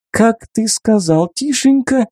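the woman turns on her former lover, mocking; ominous smirk. A narrator's reading. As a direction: purr it with a cold smile. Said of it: "недобро усмехнулась женщина".

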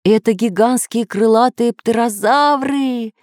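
high-pitched cry of discovery; excited cry. A narrator's reading. Professor identifies the attackers, high-pitched cry. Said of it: "закричал профессор Пыхтелкин".

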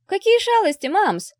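very high, loud, eager question; little girl pouncing on a secret; curious child. A narrator's reading. Daughter asks mother about fathers' pranks, eagerly curious.

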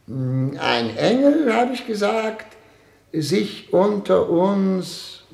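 dictating very slowly, word by word; slow dictation. Acted. Excellency dictates his diary to the poet, slow and deliberate. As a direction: spell it out slowly for the scribe.

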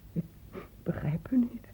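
soft unvoiced whisper. Mother whispering her confusion to the doctor. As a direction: let it drop to a confused whisper.

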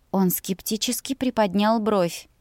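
ordinary delivery

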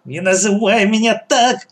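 high-pitched angry rebuke; spiteful shout. A narrator's reading. Gang boss rebukes her son, shouting spitefully. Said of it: "закричал Черногорская злобно".

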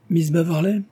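high, lifted call to her; exclaiming. A narrator's reading. Calling to Miss Beverley on the promenade, exclaiming. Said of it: "s'écria-t-il".

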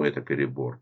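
plain reading voice

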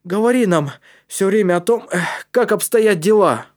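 put-on high, loud, urgent boy's voice; instructing. A narrator's reading. Калиб asks Матиас to keep them informed, urgent. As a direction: call the instruction up the pipe. Boy instructs friend going ahead.

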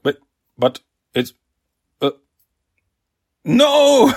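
stammer, then a high, loud cry of no; mock outrage. Villain denied a good answer, sputtering into a loud no. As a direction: burst out in cartoon villain fury. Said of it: "he gets really angry".

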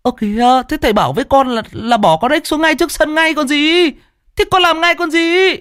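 put-on high, fast boyish voice feigning innocence; innocent boy protest. Cheeky pupil innocently answers his angry teacher. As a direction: play the cheeky kid acting innocent.

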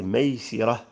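ميسرة said with "i" after the sin, maysirah; kasra on the sin. Shown as a demonstration. Teacher giving a word's three vowelings, kasra in ميسرة. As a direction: sound ميسرة as may-SI-rah. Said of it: "وبالكسر".

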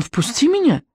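high-pitched demand, no added volume; crying out. A narrator's reading. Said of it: "крикнул он".

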